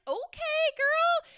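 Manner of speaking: put-on very high "okay, girl" squeal; playful high singsong approval; high-pitched hype. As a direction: cheer yourself on, way up high. Answers own playful suggestion.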